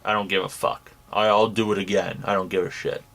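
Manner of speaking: low, careless mumbled imitation; imitating a guy who doesn't care; mock indifference. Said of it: "he just outright was like".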